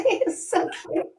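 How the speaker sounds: giggling through the words; laughing. Real, not acted. Telling followers about her husband, laughing. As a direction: laugh it out fondly.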